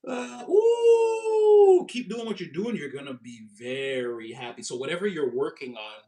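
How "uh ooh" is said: squealing laugh into a high ooh. Real, not acted. Sudden laugh at a card.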